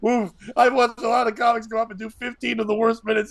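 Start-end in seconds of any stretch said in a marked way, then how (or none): none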